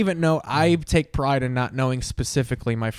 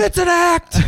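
much higher, louder, exasperated yell; upset yell; 6 dB louder than usual. Retold or imitated. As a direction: snap at the audience. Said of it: "you were just so upset".

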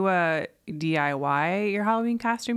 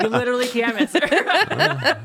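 laughing; 6 semitones higher, 5 dB louder than usual. Real, not acted. Laughing at inflatable costume joke.

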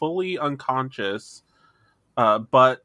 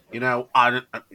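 normal talking voice

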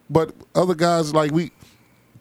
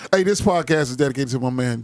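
normal talking voice

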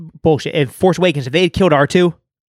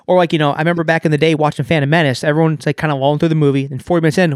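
normal talking voice

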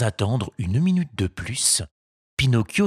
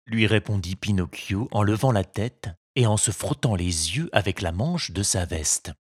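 ordinary delivery, nothing marked

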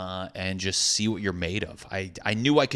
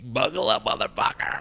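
brain's reckless voice; 3 semitones higher than usual. Retold or imitated. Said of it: "my brain gets in the driver's seat and is like".

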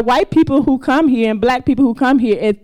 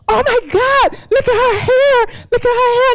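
very high, gushing imitation of the nurses; 12 semitones higher than usual. Nurses cooing at a newborn's hair, high and gushing. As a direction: squeal it like delighted nurses cooing over a baby. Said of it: "And they said".

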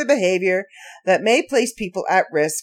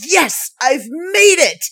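excited; 9 semitones higher, 5 dB louder, slower than usual. Retold or imitated. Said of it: "I was like".

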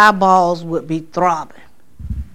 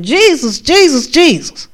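calling on Jesus, rising higher and higher; desperate calling out; 6 semitones higher than usual. Retold or imitated. Pinned down in a dream, calling on Jesus. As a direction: cry out for help, pitch climbing.

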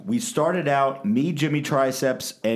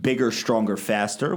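ordinary delivery

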